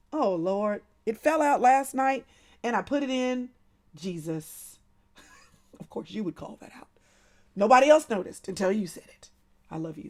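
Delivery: ordinary talk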